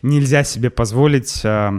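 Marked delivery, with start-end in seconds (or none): none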